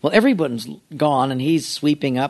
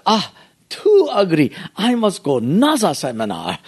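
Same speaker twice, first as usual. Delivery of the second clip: mock bonsai master; 3 semitones higher than usual. Mocks pastors in the master's voice.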